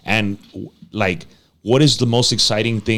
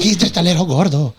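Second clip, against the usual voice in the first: put-on high baby-talk for a dog; high-pitched voice; 6 semitones higher than usual. Shown as a demonstration. Host showing how dogs make him talk, high-pitched. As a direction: show the voice people use on puppies. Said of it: "have to talk in a high-pitched voice".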